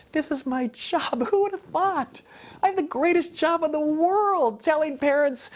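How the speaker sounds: high, bright, laughing joy; delighted gush. Real, not acted. Speaker gushes about his job to the audience, excited. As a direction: burst out with happy disbelief.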